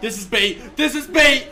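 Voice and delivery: real building panic; rising panic. Real, not acted. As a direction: voice climbing into fear. Panicking at horror trap.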